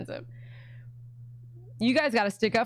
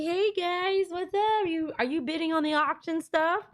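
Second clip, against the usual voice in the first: higher, chirpy dog voice greeting viewers; voicing Brownie; 6 semitones higher than usual. Owner voices her dog Brownie greeting viewers, higher and chirpy. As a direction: speak as the little dog saying hi.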